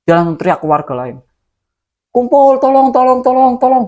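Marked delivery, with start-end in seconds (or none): 2.1-3.8 high, loud calls to gather the villagers; shouting for help. Retold or imitated. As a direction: yell across the village for help. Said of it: "Dia teriak". (teriakan keras memanggil warga)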